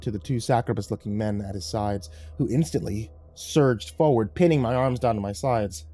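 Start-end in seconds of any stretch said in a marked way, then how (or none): none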